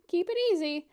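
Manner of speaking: "Keep it easy" high, loud and laughing; laughing correction of own wording; laughing at her slip. Real, not acted. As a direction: laugh at the mix-up.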